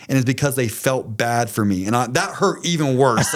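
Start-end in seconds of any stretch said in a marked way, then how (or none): none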